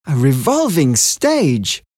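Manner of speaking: Adrian, impressed and wondering; impressed question. A narrator's reading. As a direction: repeat it in awe. Adrian repeats.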